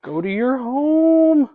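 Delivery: high, slow, teasing scold; mock scolding. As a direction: scold it like a stubborn pet. Scolding the winch cable playfully.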